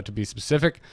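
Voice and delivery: usual speaking voice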